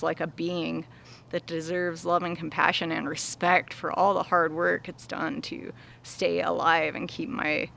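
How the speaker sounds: usual speaking voice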